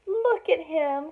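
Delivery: high, loud and drawn out with delight; excited cry. Acted. Elephants cooing over the newborn, excited and loud. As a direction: gush over the new baby. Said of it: "cried the other elephants excitedly".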